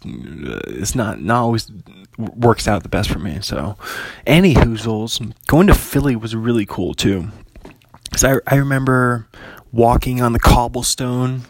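ordinary talk

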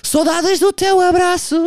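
milk the line like a tearful tribute; mock sentimental singer. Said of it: "a dizer cenas assim". (imitar cantor saudoso, lento e agudo)

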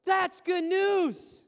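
loud, slow, ringing proclamation; exultant shout. Acted. Pastor rejoicing that God doesn't condemn, loud and slow. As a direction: throw the good news to the back row.

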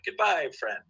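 high, slow repeated robot farewell; lightly voiced animatronic on a loop; robot goodbye.

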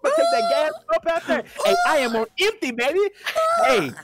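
high, loud hyped-up yell; hollering at the screen, worked up; excited shout. Real, not acted. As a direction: shout it out, fired up over the video. Hyped gawking at dancer video.

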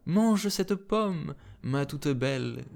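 high, slow, drawn-out coaxing; coaxing old woman. Acted. Old woman offers the apple, slowly coaxing. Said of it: "dit la petite vieille".

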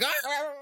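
high, short straining "Ah, ah, ah" cries; mock strained cries. Imitating Riku resisting the darkness, high mock strained cries. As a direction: ham up Riku fighting the darkness inside. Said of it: "he's like".

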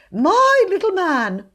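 high, loud, drawn-out wheedling; uneasy, falsely jovial address; nervous. A narrator's reading. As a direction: humour the child nervously. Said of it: "laughed nervously".

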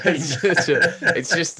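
laughing mid-sentence. Real, not acted. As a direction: giggle the words out. Laughing at John's voice.

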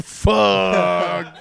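loud, drawn-out shout of dismay; exasperated outburst. Retold or imitated. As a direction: let the retold curse ring out in defeat. Retold dismay at seeing him again.

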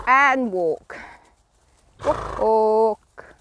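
long, loud walk; drawn-out command. Real, not acted. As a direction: stretch the word out for the horse.

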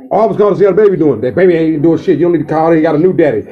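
usual speaking voice

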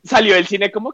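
ordinary delivery

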